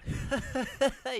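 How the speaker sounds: loud high villain cackle; big gloating laugh. Acted. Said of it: "He laughed out loud".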